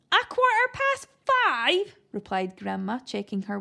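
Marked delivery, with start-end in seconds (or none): 0.1-2.0 high granny voice. A narrator's reading. Deaf granny mishears.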